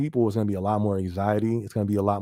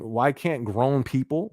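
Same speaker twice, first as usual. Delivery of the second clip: usual speaking voice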